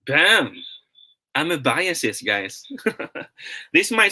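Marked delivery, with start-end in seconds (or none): 1.4-2.2 amoebiasis said am-uh-BY-uh-sis, long I in the middle; big reveal pronunciation. Shown as a demonstration. Teacher revealing the answer to his viewers: am-uh-BY-uh-sis. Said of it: "This word should be pronounced as".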